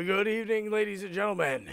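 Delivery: big, sweeping stage welcome; showman's greeting. Pretend. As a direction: open the show like a ringmaster. Show-style opening greeting.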